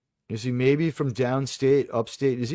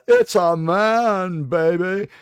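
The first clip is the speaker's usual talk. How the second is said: movie catchphrase; 4 semitones higher, much slower than usual. Retold or imitated. Quotes a movie catchphrase, drawn out.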